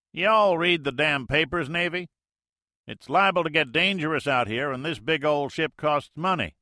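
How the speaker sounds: folksy Mississippi skipper talk, loud; Mississippi accent. Acted. Major answers the Navy plane in Mississippi accent, loud and folksy. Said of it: "The major's Mississippi accent".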